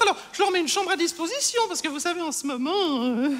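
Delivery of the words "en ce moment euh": normal talking voice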